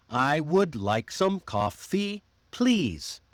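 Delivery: slow "I would like some coffee, please," on a deliberate beat; rhythmic stressed beats. Shown as a demonstration. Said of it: "only when you say a stressed word".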